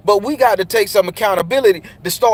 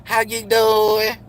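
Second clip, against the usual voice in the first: small high-voiced boy's hello; the boy's greeting before being told; high timid greeting; 3 semitones higher, much slower than usual. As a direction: mimic the boy's thin greeting. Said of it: "the little boy was like".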